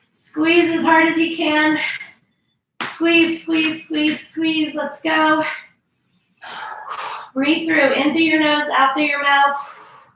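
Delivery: energetic trainer counting down a hold; fitness coach hype. Acted. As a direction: push the class through the burn. Pumping up class during hold.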